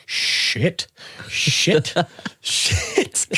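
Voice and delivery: mock panicked muttered curse with a laugh. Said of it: "Should have seen my face".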